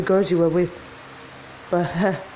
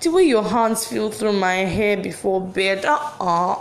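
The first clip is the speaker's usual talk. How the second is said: ordinary delivery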